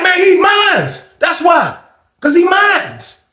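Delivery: high, slow, hammed-up boast of ownership; slow high mock yell. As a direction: drag out each word, high and slow, like a proud yell. Pooh insisting he owns Cortez.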